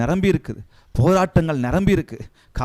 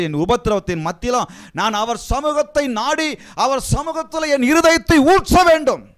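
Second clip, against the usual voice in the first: builds from steady to a high, loud cry; rising preaching; 10 semitones higher, 4 dB louder than usual. Acted. Preacher lifting the congregation to a high, loud cry. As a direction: swell the plea higher and louder.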